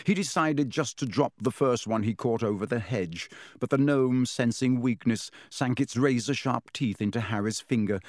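plain reading voice

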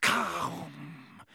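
very slow, drawn-out sinister whisper; ice-cold venomous whisper. A narrator's reading. Disembodied voice hungers to kill, venomous whisper. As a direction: breathe it out to chill the bone marrow. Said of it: "ice-cold venom".

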